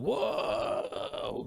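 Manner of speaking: long drawn-out, hushed whoa; mock awe, dragged right out; amazed whoa. Podcaster imagining watching it on drugs, drawn-out amazed whoa. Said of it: "I'm like, ... if I was on drugs".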